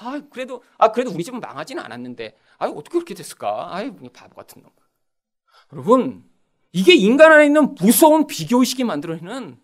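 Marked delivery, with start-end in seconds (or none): 0.0-4.7 soft, under-the-breath acted musing; privately relieved. Reunion-goer privately comparing afterwards, softly relieved. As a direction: drop to a quiet aside to yourself. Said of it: "나중에는 막".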